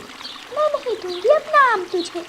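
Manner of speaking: put-on high, defiant child's cry; defiant little girl. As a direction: refuse to give up the mango. Little bird refuses defiantly.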